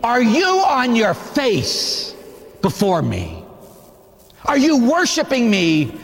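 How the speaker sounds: voice of God. Acted. God rebuking judgmental churchgoers, slow stern command. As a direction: speak as God calling the people back. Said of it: "God's saying".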